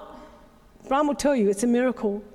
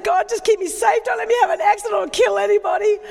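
loud, higher pleading cry to God; loud, faster desperate plea to God; desperate prayer; 8 semitones higher, 4 dB louder, faster than usual. Retold or imitated. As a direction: beg God for safety, raised and urgent. Desperate prayer while wailing in car.

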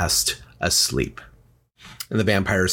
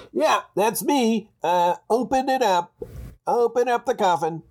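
high, muffled voice from inside a coffin; 12 semitones higher than usual. Acted. Vampire asks Kate to open his coffin, muffled voice. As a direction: beckon in a very high, strangled creature voice. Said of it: "where the voice is coming from".

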